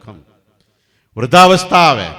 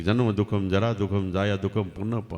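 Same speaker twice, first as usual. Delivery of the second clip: softer, musing recital of the verse; pondering murmur; 3 semitones lower, 7 dB quieter than usual. Retold or imitated. King quietly ponders the boy's verse to himself. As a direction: murmur the lines as if turning them over in your mind. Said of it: "રાજા વિચાર કરે છે".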